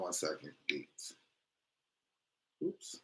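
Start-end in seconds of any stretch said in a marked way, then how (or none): none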